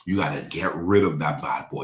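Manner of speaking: ordinary delivery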